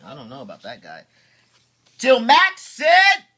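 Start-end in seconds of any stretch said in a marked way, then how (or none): 2.0-3.2 louder, higher, slow lead-in; storyteller raising the moment; dramatic build-up. Acted. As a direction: build suspense before the line.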